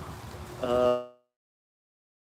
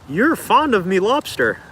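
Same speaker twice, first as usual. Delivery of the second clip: put-on high, drawn-out old-time character voice; film character impression; 7 semitones higher, slower than usual. Quoting a Lighthouse character to viewers, hammed-up slow impression. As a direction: stretch it out slowly, pitched up.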